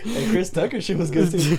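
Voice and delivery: laughing through the words. Real, not acted. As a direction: laugh while speaking. Laughing while talking.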